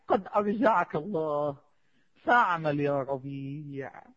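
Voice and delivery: low, long-drawn solemn address; slow solemn admonition. Acted. Preacher recounting Rabi's address to himself, slow solemn admonition. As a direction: stretch the words out, slow and low.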